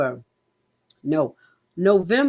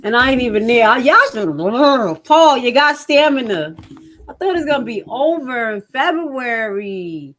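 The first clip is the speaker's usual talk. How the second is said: exasperated outburst; 6 semitones higher, 5 dB louder than usual. Real, not acted.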